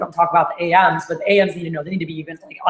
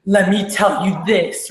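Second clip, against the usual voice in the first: slow, dramatic sales build-up; Mary Lou; slower than usual. Retold or imitated. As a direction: imitate a manager teasing a big deal, drawn out. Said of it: "if you hear Mary Lou give deals".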